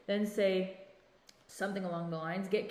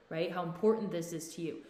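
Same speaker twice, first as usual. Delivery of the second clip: ordinary delivery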